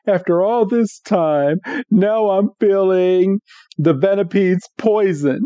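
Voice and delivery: mock agony with stretched words; imitated poisoned Meowth. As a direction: play a poisoned creature, strained and dramatic. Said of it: "Meowth is like".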